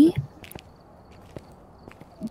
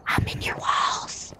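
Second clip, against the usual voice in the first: slow, eerie whisper; creepy whisper; 8 dB quieter, slower than usual. Pretend. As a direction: breathe it slowly like a lurking ghost. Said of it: "So creepy".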